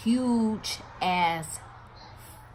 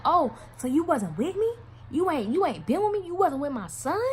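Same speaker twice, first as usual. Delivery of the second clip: high-pitched accusing questions; indignant confrontation; 8 semitones higher than usual. Retold or imitated. Mother confronts the man denying their relationship, high and indignant. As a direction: challenge him with rising disbelief.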